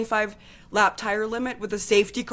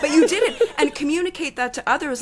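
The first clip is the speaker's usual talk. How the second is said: raised voice; 7 semitones higher than usual. Real, not acted. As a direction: get carried away and pitch it up. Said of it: "sorry, I need to lower my voice".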